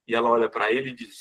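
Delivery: usual speaking voice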